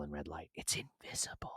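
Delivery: hushed, voiceless repeat; dramatic whisper. Real, not acted. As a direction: whisper it like a grim secret.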